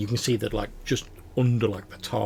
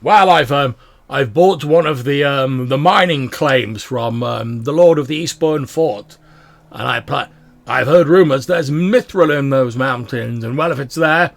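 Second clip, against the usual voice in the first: hearty dwarf; 5 semitones higher, 11 dB louder than usual. Acted. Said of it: "He's like, um".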